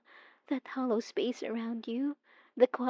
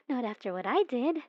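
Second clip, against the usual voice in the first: ordinary talk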